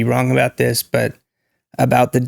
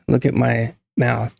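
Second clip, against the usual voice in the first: ordinary talk, nothing marked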